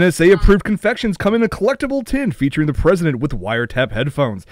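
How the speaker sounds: announcer voice fighting off a laugh; parody ad read. Acted. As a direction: sell the candy like a cheesy commercial. Reading parody candy ad.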